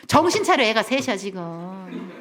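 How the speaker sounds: wife scolding a husband who wants to quit; scolding spouse. Retold or imitated. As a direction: snap at him to get a grip. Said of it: "이러지 말고".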